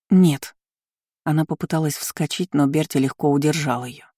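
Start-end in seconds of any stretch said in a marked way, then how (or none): 0.1-0.5 alarmed no. A narrator's reading. Frightened refusal to marry.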